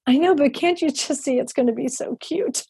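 high-pitched, gushing retold enthusiasm; gushing plea. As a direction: plead the case in a high, eager voice. Pleading her case to friend.

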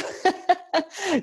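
short high sheepish laugh; a quick embarrassed giggle. Real, not acted. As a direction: laugh at yourself for not doing it. Laughs at own confession.

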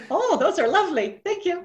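high, dainty appreciation; mock-sweet thanks. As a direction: play at polite admiration. Acted mock-polite compliment on muscles.

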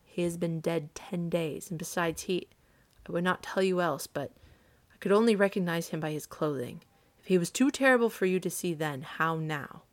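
ordinary delivery

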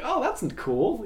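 playing up being pleasantly surprised; mock delight. His reaction when recognized.

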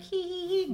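high, mock little-girl giggle "hee hee hee"; girlish giggle. Wife teases husband about giggling like a little girl, mock girlish giggle. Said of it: "giggling like a little girl".

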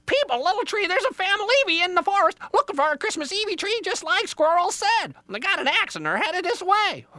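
shrill-high, loud bird alarm; frantic Jaybird. Acted. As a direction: squawk out urgent news like a panicked bird. Squawking Jaybird raises the alarm.

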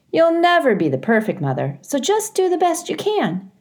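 gently voiced advice giver; lightly voiced mother. A narrator's reading.

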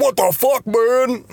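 high, indignant confrontation; mock outrage at the stranger; angry boyfriend. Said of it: "her douche boyfriend comes up".

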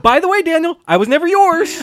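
loud, high-pitched imitated rejection; hammed-up indignant girlfriend; mock rebuff. Acts out Jessica rejecting Daniel.

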